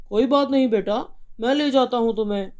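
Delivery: comforting his sick daughter; reassuring father. Acted.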